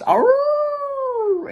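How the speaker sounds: high drawn-out howl; wolf howl. Acted. Reader voicing the wolfling's howl in the song.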